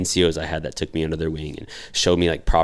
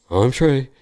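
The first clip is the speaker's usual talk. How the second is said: high-pitched, louder self-imitation; mock-meek introduction; 8 semitones higher, 6 dB louder than usual. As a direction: introduce yourself like the clueless new guy. Same awkward introduction, retold.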